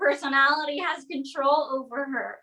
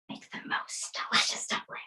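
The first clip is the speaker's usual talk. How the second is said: whisper; 9 dB quieter than usual. Real, not acted. Quiet moment in the kitchen.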